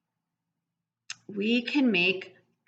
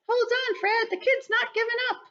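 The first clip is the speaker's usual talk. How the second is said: much higher, excited squeak; excited bug voice. Acted. Bug tells Fred the kid keeps trying, excited. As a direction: tell Fred the news, thrilled.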